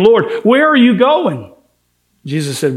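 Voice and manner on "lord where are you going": Peter's urgent question, higher and louder; eager Peter. Retold or imitated. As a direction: ask it like the impatient disciple calling out. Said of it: "Simon Peter said to him".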